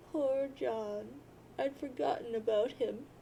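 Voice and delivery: normal talking voice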